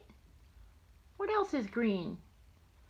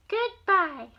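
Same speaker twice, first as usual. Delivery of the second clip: high, louder goodbye; high dog goodbye; 9 semitones higher, 5 dB louder than usual. Acted. Grandma voicing a dog for her grandson, high goodbye. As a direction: chirp goodbye as the little dog.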